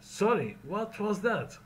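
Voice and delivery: puzzled high-pitched sorry, what was that; asking to repeat. Shown as a demonstration. Teacher modelling a request to repeat, puzzled. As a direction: sound confused, ask them to say it again. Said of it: "Number one".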